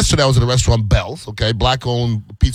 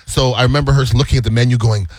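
ordinary delivery

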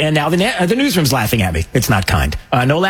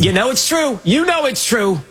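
teasing insistence; 11 semitones higher than usual. Acted. Host teasing emphatic aside.

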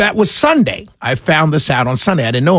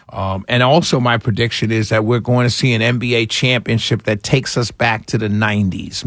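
ordinary delivery

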